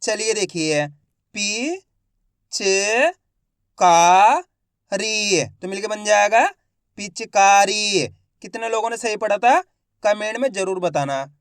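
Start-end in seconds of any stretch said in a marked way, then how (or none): 1.3-8.1 each syllable said alone, loud and clipped, then the whole word drawn out slowly; slow Hindi reading drill, syllable by syllable; phonics sounding out. Shown as a demonstration. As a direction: spell it out syllable by syllable for a child, then blend it slowly. Teacher sounds out and blends words.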